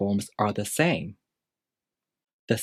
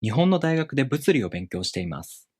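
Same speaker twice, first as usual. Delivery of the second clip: "日本の大学で物理を勉強しています" read briskly, rising early then stepping down; model Japanese intonation; 3 semitones higher, faster than usual. Shown as a demonstration. Teacher models intonation for learners to compare, "日本の大学で物理を勉強しています" briskly stepping down. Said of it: "compare your intonation to my intonation".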